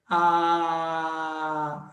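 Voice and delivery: A sustained on one steady note; held open vowel. Shown as a demonstration. Teacher shows class sound passing freely, held vowel. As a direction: hold A long, letting it flow freely. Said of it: "o som passa livremente ... Faça".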